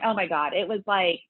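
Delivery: ordinary talk, nothing marked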